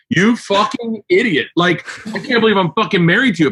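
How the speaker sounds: worked-up comic exasperation, not truly furious; exasperated mock rant. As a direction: rant in mock outrage at your spouse. Mock rant at his wife.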